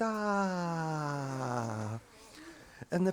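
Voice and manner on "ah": put-on much softer calm baby sound; a settled little sigh; contented baby sigh. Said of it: "And the baby went".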